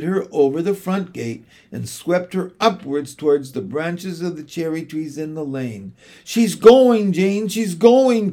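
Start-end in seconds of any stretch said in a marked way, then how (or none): 6.2-8.3 high, loud, then slowing distressed cry; a boy crying out as she leaves; crying out, weeping. Acted. Michael crying to Jane as Mary Poppins leaves, high and loud. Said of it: "cried Michael, weeping".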